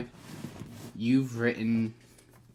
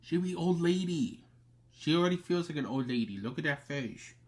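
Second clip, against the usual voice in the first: higher cooing pet talk; talking to the dog; 5 semitones higher than usual. Real, not acted. Cooing at her old dog.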